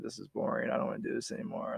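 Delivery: low, quiet retelling of being fed up; mildly dejected, dropped in pitch and volume; depressed. Retold or imitated. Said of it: "I was depressed".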